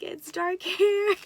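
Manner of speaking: high, loud imitation breaking into laughter; excited husband. Husband telling the doctor the hair color, mock excited. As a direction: pitch climbs as a laugh bursts in.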